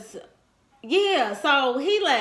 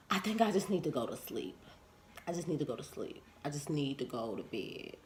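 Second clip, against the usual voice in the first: much softer, fading, deflated; a low, soft retreat out of the conversation; quiet weary brush-off; 7 semitones lower, 14 dB quieter, faster than usual. Retold or imitated. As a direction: mumble it like you're about to fall asleep. Retold tired brush-off to him.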